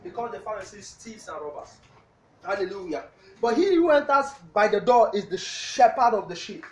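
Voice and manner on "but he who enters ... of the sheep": loud, fast sermon reading of the verse; preaching scripture reading. Real, not acted. Pastor reading the verse to the congregation, preaching loud. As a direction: boom out the scripture to the church. Reads scripture at full force.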